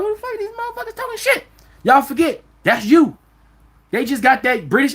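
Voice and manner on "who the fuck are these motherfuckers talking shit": high, thin voice, outraged; a shocked squeal of offence; indignant outrage. Retold or imitated. Mocking the group's outraged reply to Brits talking online. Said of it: "we like".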